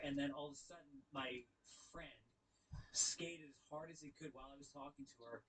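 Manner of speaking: ordinary talk, nothing marked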